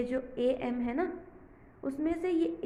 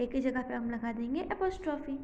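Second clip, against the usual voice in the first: normal talking voice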